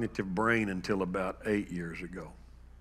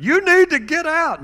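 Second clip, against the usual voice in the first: much higher, louder shrill-pitched command; shouted order; 15 dB louder than usual. Retold or imitated. Mother ordering the son she no longer knows out, shouting.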